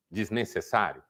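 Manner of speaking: usual speaking voice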